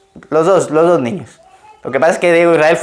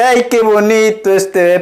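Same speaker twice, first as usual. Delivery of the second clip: mock admiring nurse; 5 semitones higher than usual. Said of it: "nace el bebé y dice".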